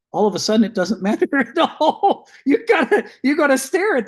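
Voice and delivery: words burst out through a laugh; laughing while talking. Real, not acted. Laughs mid-sentence at the sudden idea.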